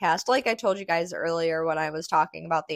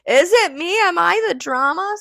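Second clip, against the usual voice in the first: much higher and louder, playful; mock dramatic; 12 semitones higher, 7 dB louder than usual. Podcaster teasing herself to listeners, high and loud mock drama. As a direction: squeal it like a melodramatic question. Mock self-questioning, joking.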